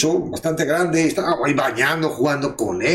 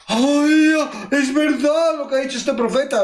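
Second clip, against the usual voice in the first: mock amazement; 9 semitones higher than usual. Mocking a gullible believer.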